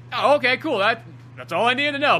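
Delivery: relieved. Retold or imitated. Retelling relief at the diagnosis.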